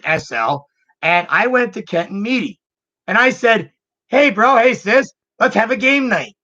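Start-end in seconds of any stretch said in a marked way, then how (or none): 4.1-6.3 bright, louder call to siblings; hey bro, hey sis, pitched up and eager; calling out an eager invite to play; cheery invitation. Retold or imitated. Inviting siblings to game night.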